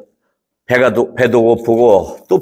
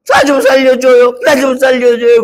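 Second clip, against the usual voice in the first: mock pleading; 12 semitones higher, 4 dB louder than usual. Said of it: "그러면서 고생한단 말이에요".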